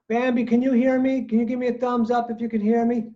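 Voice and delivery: calling out. Real, not acted. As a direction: call across to check they can hear. Checking a student's audio on Zoom.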